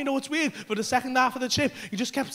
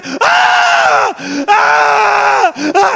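very high, loud shrieks; full-volume acted-out screams; imitated screaming; 9 semitones higher, 9 dB louder, much slower than usual. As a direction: scream relentlessly as the tripping friend. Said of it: "stop screaming".

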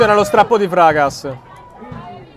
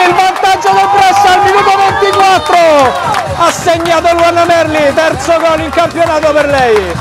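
high, loud goal call; breathless italian goal commentary; 9 semitones higher, 5 dB louder than usual. Real, not acted. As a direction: shout the goal, rising with joy. Goal scored, commentator erupts.

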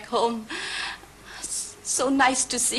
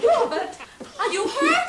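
alarmed call; 12 semitones higher than usual. Acted. Wife sees husband fall.

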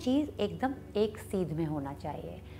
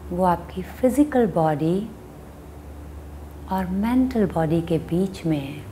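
guided meditation; 2 semitones lower than usual. Acted. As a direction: guide them unhurriedly into watching the breath. Leads a meditation.

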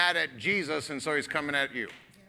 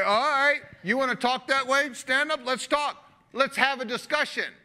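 higher, louder taunting challenge; challenging God; 9 semitones higher, 3 dB louder than usual. Acted. God daring a trash-talking Job to face off, taunting. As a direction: square up and dare him to face off.

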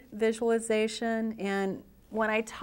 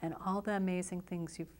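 guided visualization. Acted. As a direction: guide them gently into relaxation, phrase by phrase.

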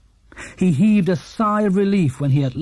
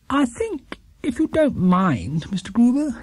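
higher, polite grateful bear voice; grateful little bear; 8 semitones higher, slower than usual. Acted. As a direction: thank him sweetly as a small bear. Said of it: "he announced gratefully".

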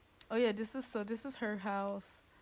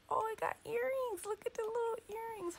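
gushing; 13 semitones higher than usual. Real, not acted. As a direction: coo it like you just spotted something adorable. Gushing over the baby.

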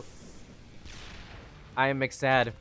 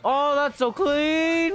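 high, slow admiring praise; delighted awe; 11 semitones higher, 4 dB louder, much slower than usual. Real, not acted. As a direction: savour the moment. Impressed by a move.

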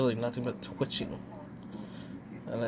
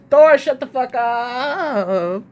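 angry yell; 10 semitones higher, 11 dB louder, much slower than usual. Real, not acted. Yelling at the barking dog.

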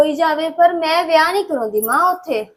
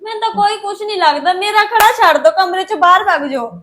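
angry dismissal; 3 semitones higher, 6 dB louder than usual. Acted. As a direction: order her out of the room. Daughter orders mother out.

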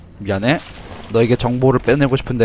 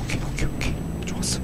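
soft relieved whisper; whispering while hiding; 8 dB quieter, faster than usual. Real, not acted. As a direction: whisper it, still unseen. Stayed hidden, relieved.

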